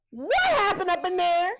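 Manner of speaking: high, loud shocked demand. Retold or imitated. Ralph catching Gemma, shouting in shock. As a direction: Ralph bursting in, alarmed.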